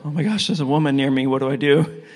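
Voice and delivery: fast, flustered mock panic; startled. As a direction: rattle it off like a startled shy man. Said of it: "like, startled".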